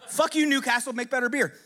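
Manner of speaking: ordinary talk, nothing marked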